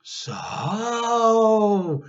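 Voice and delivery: high, loud drawn-out "So"; a boy struck by a wicked idea; gleeful dawning idea; sudden idea. Acted. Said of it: "thought George suddenly".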